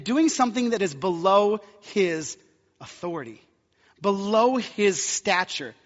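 building sermon cry; full-force preaching. Acted. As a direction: preach it hard to the congregation.

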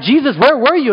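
high, loud scolding parents; hammed-up worried parent calling out; exasperated parents. Parents find Jesus in the temple, mock scolding.

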